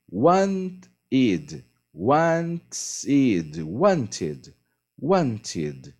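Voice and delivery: "wanted" with a clear "-ted" ending, said again and again; teacher modelling "wanted" for students; repeated pronunciation drill. Shown as a demonstration. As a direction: drill "wanted" slowly, stressing the final "ted". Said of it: "a pronúncia é wanted".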